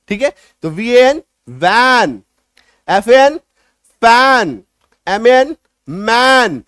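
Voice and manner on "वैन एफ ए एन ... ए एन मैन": वैन, फैन, मैन said loud with a long, pushed ae; forceful ae words. Shown as a demonstration. Teacher demonstrating forceful vowel in वैन and मैन. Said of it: "उच्चारण थोड़ा सा जोर लगा के करेंगे".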